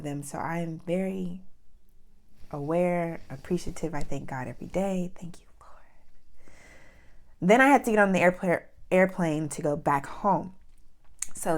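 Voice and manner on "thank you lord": slow whispered thanks to the Lord; whispered prayer. Real, not acted. Thanking God for surviving, slow whisper. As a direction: breathe it out as a private prayer.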